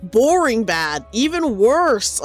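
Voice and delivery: drawn-out, unimpressed delivery; slow deadpan. Real, not acted. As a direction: stretch each word out dryly. Mocking a bad movie.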